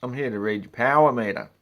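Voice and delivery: loud, bright mock-friendly greeting; hammed-up friendly meter reader; cheery greeting. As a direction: announce yourself at the door, loudly polite. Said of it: "I say".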